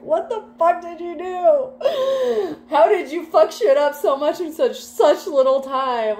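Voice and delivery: high, slow, laughing and crying in disbelief; hammed-up half-laughing scold; exasperated parent. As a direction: wail at the mess like a parent at their limit. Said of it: "Like, that's the energy".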